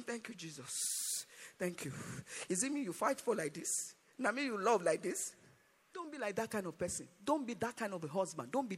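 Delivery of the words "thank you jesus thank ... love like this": mock tearful. Mourner thanking Jesus for being loved, acted tearful and hushed. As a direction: act quietly overcome, softly weeping. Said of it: "After some time, you start crying".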